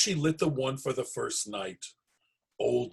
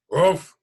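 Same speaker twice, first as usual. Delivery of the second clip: loud, higher bear bark; ruff growl; 6 semitones higher, 10 dB louder than usual. Acted. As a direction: bark a gruff goodbye. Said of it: "he growled".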